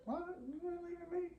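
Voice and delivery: squeaky high disbelieving quote; high-pitched shocked plea; shocked high whine. Retold or imitated. Voicing Pearl's shocked reply.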